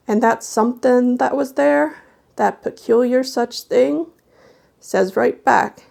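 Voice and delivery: put-on old folk tale told to a hushed room; spooky story narration. A narrator's reading. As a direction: draw the listener into a ghost tale. Reads a spooky folk tale aloud.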